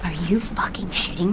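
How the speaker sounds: hushed, unvoiced whisper. Real, not acted. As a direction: whisper it while hiding. Hiding from enemies.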